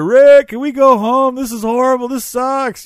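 high, loud whiny yell; kids screaming. Retold or imitated. Kids begging the youth leader to go home, high whiny yell. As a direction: shriek it like a bored kid begging to leave. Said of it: "screaming in my ear".

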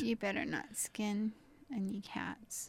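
hushed playful whisper. Pretend. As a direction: whisper the mock warning. Playful warning about skinning cat.

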